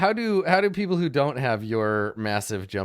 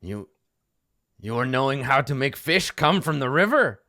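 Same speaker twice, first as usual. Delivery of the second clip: a touch louder gnoll in broken English; amazed gnolls; 3 semitones higher, 6 dB louder than usual. Acted. Gnolls ask the gnome if he can catch fish, mildly amazed. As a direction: ask in hopeful disbelief. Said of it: "they're like".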